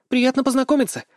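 high, delighted doll voice; joyful. A narrator's reading. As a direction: greet with bright glee. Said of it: "радостно ответила Дол". (радостно, высоким кукольным голоском)

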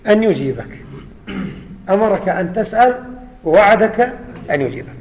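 ordinary delivery

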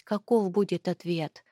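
usual speaking voice

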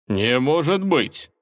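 slow mock-shocked hiss; hissed through teeth. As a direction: spit it out slowly, feigning shock. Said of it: "процедил оборотень". (процедил сквозь зубы)

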